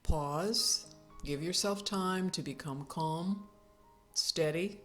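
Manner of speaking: usual speaking voice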